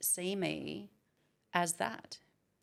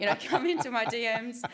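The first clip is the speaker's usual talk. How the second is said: laughing; 6 semitones higher, 6 dB louder, faster than usual. Real, not acted. Laughing while retelling DMs.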